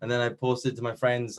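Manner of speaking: ordinary delivery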